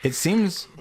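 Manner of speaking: ordinary talk, nothing marked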